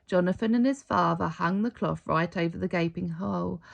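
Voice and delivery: ordinary delivery, nothing marked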